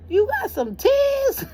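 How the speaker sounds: very high, loud, stretched squeal; mock squeal, drawn out and comical; mimicking someone. Staffer spotting tears on the caller, mimicked squeal. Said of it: "She went like this".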